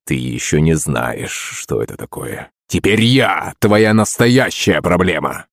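slow, deep menacing villain voice; low threatening movie villain; booming echoing villain monologue. Acted. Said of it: "голосом злодея".

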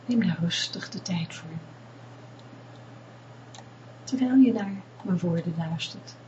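calm them down gently, close in; guided meditation. Acted. Leads a meditation. (rustige meditatiestem)

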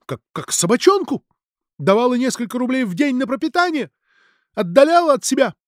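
very high indignant tirade; heated accusation. A narrator's reading. Mironova accuses Sasha of mistreating Fedor, heated. Heated quarrel between friends.

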